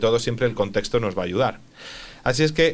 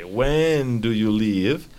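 slow, careful When do you leave; slow model question; much slower than usual. Shown as a demonstration. Teacher repeats student answer slowly to model. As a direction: stretch it out for the learner.

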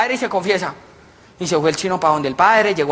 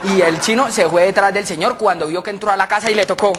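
ordinary delivery

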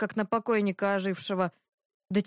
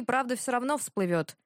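plain reading voice

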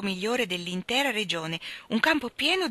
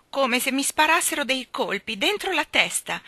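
put-on higher, louder child's complaint; dramatic complaint; 7 semitones higher, 5 dB louder than usual. A narrator's reading. Jane describing her earache to Michael, dramatic complaint. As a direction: dramatise the pain, pitched up.

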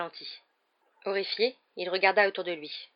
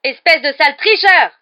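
loud, higher furious yell at a cheat; angry shout; 9 semitones higher, 17 dB louder than usual. Acted. Commentator yelling at the cheating player, angry and loud. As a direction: roar the insult into the megaphone. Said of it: "cria Lee Jordan dans le mégaphone".

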